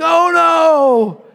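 loud, high comic wail; playing an aghast spectator; mock panic. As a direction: cry out in hammed-up horror. Vacationer alarmed at wheelbarrow stunt.

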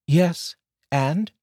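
high female voice, called out; wife calling from the kitchen in a high voice. Acted. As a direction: call back from the other room, teasing. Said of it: "Ariana shouted from the kitchen".

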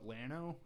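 "Llano" said as LAN-oh; Central Texas pronunciation. Shown as a demonstration. Explaining local usage to listeners, "Llano" as LAN-oh. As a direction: say "Llano" with an L and a short flat A. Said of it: "they call it Llano".

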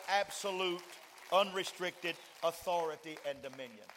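loud, rising preacher's cry; preaching at full force. Acted. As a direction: hurl it at hell like a commander. Preacher builds to shouting climax.

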